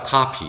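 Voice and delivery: "카피" with an open "ah" first vowel; American O as "ah". Shown as a demonstration. Teacher correcting 코피 for learners, American "ah" in "카피". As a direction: say "카피" the American way, "KAH-pee". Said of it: "아로 발음하죠".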